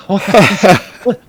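slow, loud outburst; mock shout. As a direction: shout it out slowly. Acting out character's outburst.

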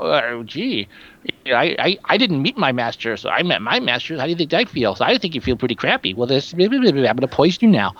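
mopey anthropologist. Retold or imitated. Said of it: "the mopey anthropologist".

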